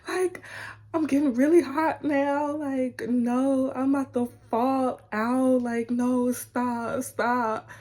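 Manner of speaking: high and loud, about to faint; breathless overjoyed nerves; overwhelmed excitement. Retold or imitated. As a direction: gush like you might pass out. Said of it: "I was like".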